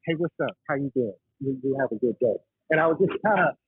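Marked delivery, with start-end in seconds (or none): 0.1-2.4 A T voice. Retold or imitated. Diver greets a nervous woman in the elevator, light A T voice. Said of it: "and I was like".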